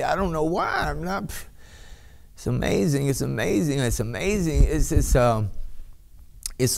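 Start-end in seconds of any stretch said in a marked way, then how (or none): none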